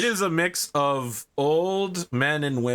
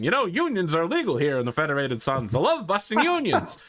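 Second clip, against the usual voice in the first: smug businessman; 11 semitones higher than usual. Acted. NPC businessman boasting.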